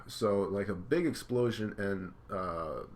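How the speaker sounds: plain reading voice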